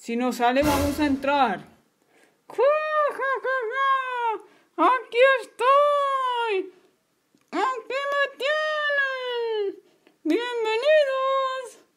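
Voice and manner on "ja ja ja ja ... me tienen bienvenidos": high, slow cackling witch; villain Molly mocking the police, high and cackling. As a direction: taunt them like a gleeful old witch.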